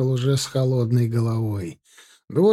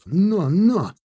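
high "Но, но!" call; urging horse; 6 semitones higher than usual. Acted. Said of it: "понукал Чайку Тимофей".

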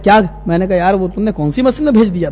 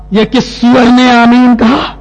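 slow, higher, loud chanted demand; imitating furious imam; 4 semitones higher, 5 dB louder, much slower than usual. Imam hunting who said amen, chanted outburst. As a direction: intone the accusation like part of the prayer. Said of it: "بالکل یہی style".

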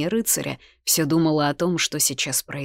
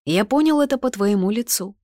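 teasing, lifted in pitch; amused jibe; mocking; 6 semitones higher, 5 dB louder than usual. A narrator's reading. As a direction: say it louder with light mockery. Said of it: "насмешливо отозвался он".

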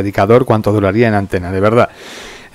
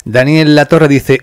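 usual speaking voice

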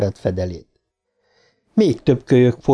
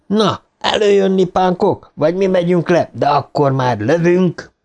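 higher-pitched mock tough-cop threat; Joe Friday; 6 semitones higher than usual. A narrator's reading. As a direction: order them out like a fearless policeman. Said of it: "a rettenthetetlen Joe Friday-nak képzelte magát". (megjátszott keményzsaru-fenyegetés)

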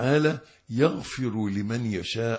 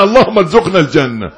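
1 fervent loud prayer; 7 semitones higher, 17 dB louder, faster than usual. Real, not acted.

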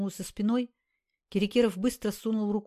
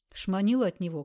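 usual speaking voice

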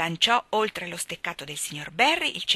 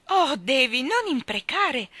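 higher, slow, dismayed plea; higher dismayed protest; 6 semitones higher, slower than usual. A narrator's reading. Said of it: "gli disse Dora costernata".

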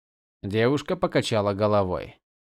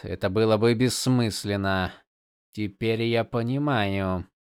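usual speaking voice

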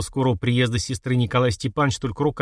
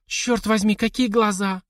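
high, breathless gasp of delight; gasping admiration; 9 semitones higher, slower than usual. Acted. Widow gasps at the young Cossack, high and breathless. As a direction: gasp at his eyes. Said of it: "ахнула Зизи".

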